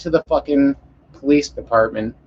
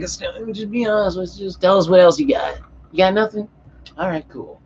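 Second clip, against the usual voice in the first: high-pitched imitation of a stoner cop; mock a coaxing cop in a raised voice; 5 semitones higher than usual. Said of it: "it was the most stoner cop I've ever seen".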